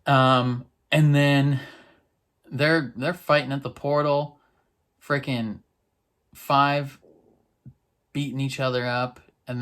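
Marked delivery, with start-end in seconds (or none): none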